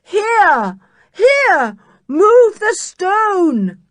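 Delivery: high loud shout, slowing at the end; yell. Acted. Julian to the rescuers, a loud high yell. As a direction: bellow so they jump. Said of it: "yelled Julian".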